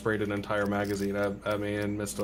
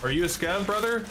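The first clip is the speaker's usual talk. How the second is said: calling out; 9 semitones higher than usual. Real, not acted. Calls out to unseen player.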